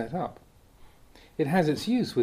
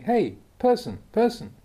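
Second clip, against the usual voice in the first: a lightly raised call, pitched high across a crowd; mock calling out; 9 semitones higher, 4 dB louder than usual. Teacher imitating someone calling across a crowd, mock calling out. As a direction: call out higher to a stranger in a crowd, half-acted. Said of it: "call out to somebody standing in a crowd".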